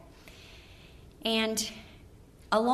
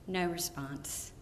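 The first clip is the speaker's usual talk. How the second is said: ordinary talk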